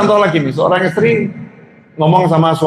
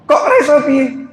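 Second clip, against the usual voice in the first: high, slow puzzled question; wife asking husband, high and baffled; 10 semitones higher, slower than usual. Retold or imitated. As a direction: ask it up high, slowly, baffled.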